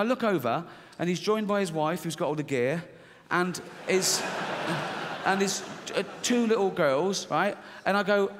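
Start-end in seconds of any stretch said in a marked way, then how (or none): none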